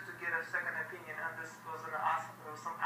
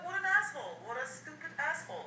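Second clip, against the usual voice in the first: outraged disbelief; 9 semitones higher than usual. Retold or imitated. Retold outrage at the doctor.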